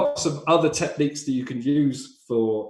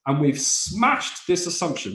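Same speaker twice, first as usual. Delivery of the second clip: ordinary talk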